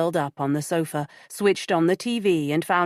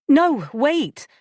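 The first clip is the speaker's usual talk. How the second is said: urgent, higher and louder; 9 semitones higher, 6 dB louder than usual. Acted. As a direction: stop him before he hangs up. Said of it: "Molly said quickly".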